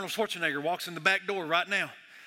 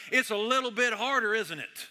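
ordinary talk

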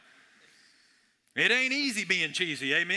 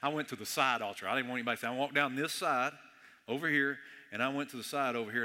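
usual speaking voice

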